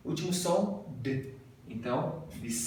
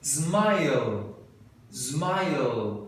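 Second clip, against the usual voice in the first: normal talking voice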